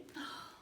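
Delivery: soft, dismayed sigh; exasperated sigh. Acted. Reader dismayed at being a monkey, exasperated sigh. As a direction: let out a put-upon sigh.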